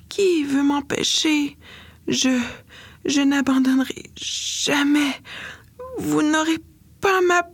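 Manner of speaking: put-on a delirious girl lamenting she won't give up. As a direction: wail against the world, feverish. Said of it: "se lamente Jenny".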